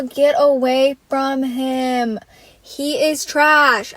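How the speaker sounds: ordinary delivery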